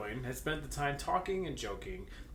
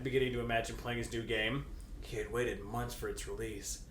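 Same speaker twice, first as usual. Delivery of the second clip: ordinary talk, nothing marked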